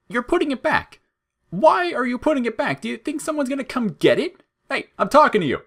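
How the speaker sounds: very high, loud and far off; frantic high shriek. A narrator's reading. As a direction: demand an answer in alarm. Flowey shrieking frantic questions.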